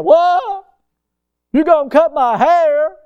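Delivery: a recruit gasping at the drill sergeant over his hair; mock shock. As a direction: play the horrified new recruit. Recruit shocked at boot camp.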